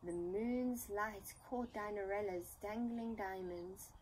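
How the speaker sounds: ordinary delivery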